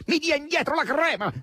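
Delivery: very high, exasperated outburst; nearly furious. A narrator's reading. Asasello rounds on Margherita, almost furious. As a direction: erupt in high-pitched indignation. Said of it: "si infuriò quasi Asasello".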